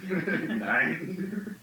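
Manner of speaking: laughing through a word; amused giggling question. Real, not acted. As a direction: laugh as you ask it. Laughing at own childhood memory.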